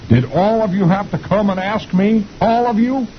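very high cartoon exasperation; exasperated general. General scolds the whole army, high exasperated whine. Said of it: "he says".

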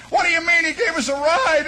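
high-pitched mock shout; indignant protest. Hitchhiker objecting to MPs about testifying, high indignant shout.

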